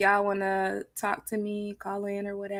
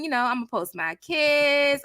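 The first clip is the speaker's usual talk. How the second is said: normal talking voice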